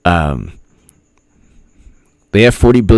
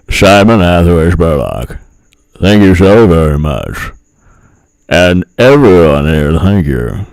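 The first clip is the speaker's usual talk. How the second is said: encore impression; 4 semitones lower than usual. Thanking Simon in a lightly put-on impression voice. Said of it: "Oh, I'll do an encore".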